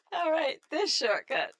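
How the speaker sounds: slow, high and loud through laughter; laughing loudly. Real, not acted. Player after a botched shortcut, laughing loudly. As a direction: belt it out, cracking up at the blunder.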